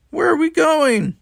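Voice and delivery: very high, loud shouted question. A narrator's reading. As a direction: yell it while running. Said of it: "shouted Mark".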